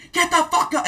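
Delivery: high, loud barked order; drill sergeant shouting. Retold or imitated. Drill sergeant storming through the barracks, shouting. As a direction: yell to roust sleeping soldiers. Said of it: "you know, and I hear".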